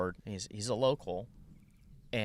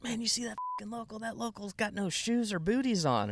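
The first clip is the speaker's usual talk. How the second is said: high, fast mock LA surfer; high-voiced impression of LA visitors; imitated LA surfer; 13 semitones higher than usual. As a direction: gossip like an astonished out-of-towner, pitched way up. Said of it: "people from L A are like".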